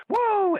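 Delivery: high-pitched imitation wrestler's whoop; Ric Flair whoop. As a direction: let out a high showman's "woo". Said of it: "then Flair does the Flair strut".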